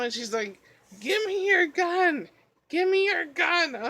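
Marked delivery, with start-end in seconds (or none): 1.0-3.8 insistent sleeping mom. Retold or imitated.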